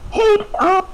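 very high-pitched imitation girl voice. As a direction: put on a squeaky-high girl's falsetto.

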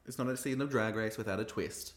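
ordinary delivery

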